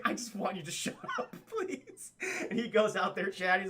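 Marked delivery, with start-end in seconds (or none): 0.0-2.1 high, laughing through the words; laughing plea. Real, not acted. Streamer laughs while voicing husband begging wife to stop. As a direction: beg while cracking up.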